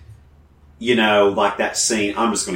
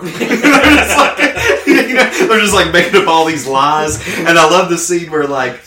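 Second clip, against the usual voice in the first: high, loud words through laughter; laughing; 8 semitones higher, 8 dB louder than usual. Real, not acted. Fan laughing at the show's comedy, laughing speech. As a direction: laugh while you talk.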